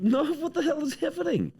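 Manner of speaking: hammed-up high-pitched panic; mock panic. Real, not acted. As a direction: shriek as it falls apart. Toy falls apart again.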